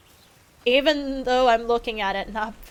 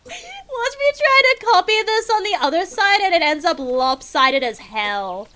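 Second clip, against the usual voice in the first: self-mocking; 7 semitones higher, 4 dB louder than usual. Real, not acted. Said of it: "watch me make it lopsided".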